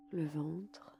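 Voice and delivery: ordinary talk, nothing marked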